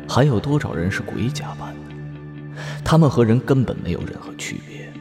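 ordinary talk

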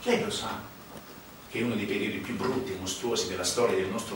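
low, soft needling of the listener; cynical insider. Acted. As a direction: lean in and provoke quietly.

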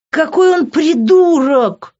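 high, loud, drawn-out shout; outraged holler; furious yell. A narrator's reading. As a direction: scream it in exasperation. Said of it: "заорала я".

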